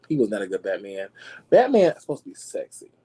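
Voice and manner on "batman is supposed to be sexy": excited rant. Real, not acted. Ranting about Batman.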